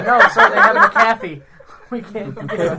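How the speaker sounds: half-laughing panicked report to the general; panicked soldier. Acted.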